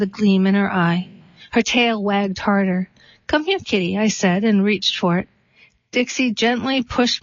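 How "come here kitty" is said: coaxing kitty call. Retold or imitated. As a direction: lure the kitten out gently. Coaxing a kitten out.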